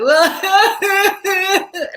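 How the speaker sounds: high, loud imitated sob-laugh; sobbing burst. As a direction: burst into a full-on mock sob. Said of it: "like, full on".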